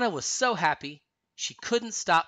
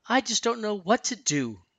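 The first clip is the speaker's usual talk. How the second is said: higher, plaintive falling line; plaintive, pitched-up misery; miserable; 6 semitones higher than usual. A narrator's reading. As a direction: let it sink miserably from a high start. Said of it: "she said miserably".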